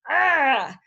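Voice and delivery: loud cringing "ah"; loud exasperated cringe; pained groan. Real, not acted. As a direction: recoil from the phrase with a loud groan. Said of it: "that's like nails on a chalkboard".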